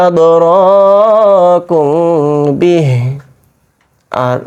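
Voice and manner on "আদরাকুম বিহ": Quran recitation. Shown as a demonstration. Teacher demonstrating tajweed to students, slow chanted recitation. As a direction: intone আদরাকুম like tilawat, long held vowels. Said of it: "খেয়াল করেন".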